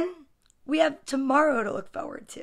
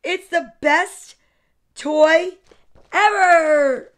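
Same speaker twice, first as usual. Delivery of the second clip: higher, slow, building to a loud wail; gushing excited boy; 4 semitones higher, 7 dB louder, much slower than usual. Acted. Boy defends the box to mother, ecstatic wail. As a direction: stretch each word, building to a cry.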